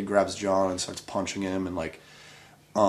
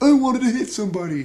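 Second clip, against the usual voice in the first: much higher, put-on voice of a guy who missed out; whiny mock voice; 13 semitones higher, 3 dB louder than usual. Mocking Mike who missed the fight, high whiny voice. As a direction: pitch it way up like a disappointed kid. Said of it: "and he's like".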